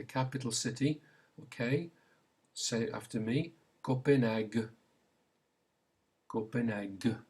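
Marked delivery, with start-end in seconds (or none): 3.8-7.2 "Copenhagen" said the French way, ko-pen-AG, hard g ending; French pronunciation. Shown as a demonstration. Teacher says it for learners to repeat, French pronunciation. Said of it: "There's the g sound".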